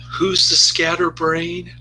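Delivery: high, drawn-out mocking question; sarcastic. Teenage daughter talking back to her scatterbrained mother, sarcastic. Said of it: "Kinda sarcastic".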